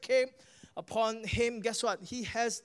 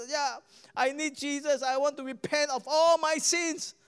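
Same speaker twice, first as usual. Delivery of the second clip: higher, raised retelling of a shout; mock shout; 6 semitones higher, 4 dB louder than usual. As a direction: lift it like a man crying out. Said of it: "He shouted out".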